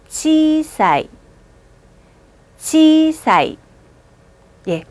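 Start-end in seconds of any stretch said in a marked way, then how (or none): 0.1-3.6 치사이 with 사이 glided in one, slow; 치사이, read it in one smooth go; long vowel. Shown as a demonstration. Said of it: "읽어볼까요". (치사이 한 번에 길게)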